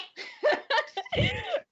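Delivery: high cackle; a witchy crone cackle; high hag cackle; cackling laugh. Acted. NPC laughs after "eh.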